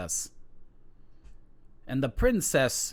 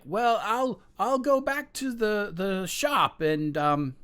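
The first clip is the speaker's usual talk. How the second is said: nervous son; 8 semitones higher than usual. Acted. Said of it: "the son's like".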